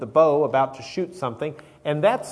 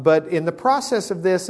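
ordinary talk

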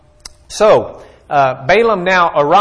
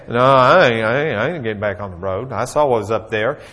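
donkey voice; 5 semitones lower than usual. Acted. Donkey answers Balaam.

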